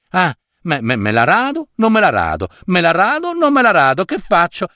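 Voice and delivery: mimicking his dithering, question against answer; mocking indecision. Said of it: "Due ore per risolversi". (imitazione canzonatoria di un indeciso)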